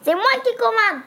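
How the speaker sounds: high bossy pixie voice; a little elf who gives the orders. Acted. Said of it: "dit le lutin".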